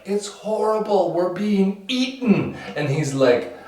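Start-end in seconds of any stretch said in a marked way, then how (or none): 0.0-2.6 high-pitched mock distress; panicked villagers. Village complains of the killing spree, high and distressed. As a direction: wail it up high. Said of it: "Village is like".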